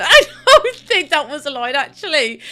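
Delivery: loud, laughing through it; cracking up while objecting; laughing protest. Real, not acted. Streamer laughing while objecting to Lauren, laughing.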